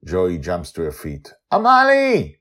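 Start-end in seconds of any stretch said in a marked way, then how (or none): 1.5-2.3 much higher, loud joyful greeting; light up at seeing Joy; Amali's voice. Acted. Amali greeting Joy after danger, high and glad. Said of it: "Amali smiles and reaches forward".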